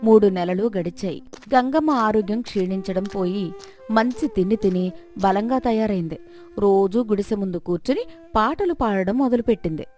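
plain reading voice

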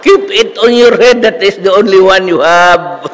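very high, loud, scolding shriek; a shrill old professor furious at a student. Retold or imitated. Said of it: "siya, shrill".

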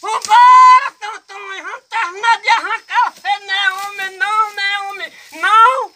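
high holler, loudest at first; scolding call. Acted. Digger scolds his runaway companion, calling out high. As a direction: goad the runaway to come back.

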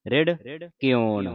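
ਰਿੜ, clear pause, ਕਿਉਣ; two separate words. Shown as a demonstration. Teacher models the reading, ਰਿੜ apart from ਕਿਉਣ. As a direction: split ਰਿੜ from ਕਿਉਣ with a gap. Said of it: "ਇਸ ਤਰ੍ਹਾਂ ਪੜ੍ਹਨਾ ਹੈ".

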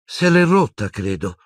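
ordinary delivery, nothing marked

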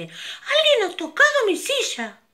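a shrieking-high mama bear; mama bear calling out. Acted. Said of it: "gruñó un poco menos fuerte".

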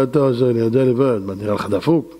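mock grumble. Mocks a mourner.

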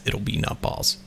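hushed whisper to yourself. Real, not acted. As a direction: breathe it out, no voice. Repeats line, second whispered.

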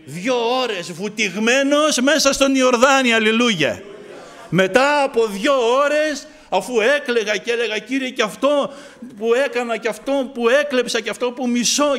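loud, fervent testimony; preaching at full force. Acted. Preacher testifying loudly to the congregation. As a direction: preach it out to the brothers.

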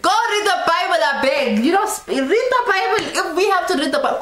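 high, insistent nagging; mock-outraged order, tumbling out; exasperated scolding.

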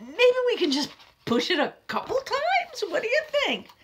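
wheedling. Acted. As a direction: coax hopefully.

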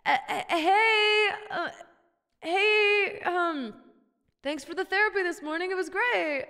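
squeaky nervous hello; awkward high greeting. Pretend. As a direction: sheepishly greet your therapist. Addressing therapist, awkward high voice.

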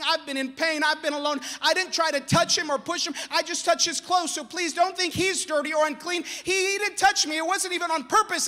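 loud, high, pleading cry; crying plea. Acted. Woman pleading to the crowd, a loud, high tearful cry. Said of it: "And she's crying".

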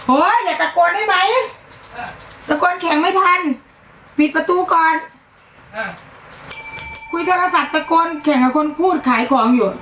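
high-pitched, exasperated scolding; real angry outburst. Real, not acted. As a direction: lose your temper at someone shouting on the phone. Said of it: "ควบคุมอารมณ์ตัวเองไม่อยู่". (โวยวายเสียงสูงด้วยความโมโห)

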